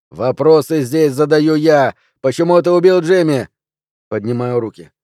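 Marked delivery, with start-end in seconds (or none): 0.1-3.5 loud, commanding cop; forceful interrogation. A narrator's reading. Policeman rebuking and questioning the suspect, loud. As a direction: raise your voice to take control.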